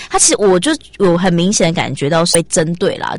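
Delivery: usual speaking voice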